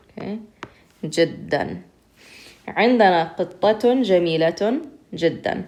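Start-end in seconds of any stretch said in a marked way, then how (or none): none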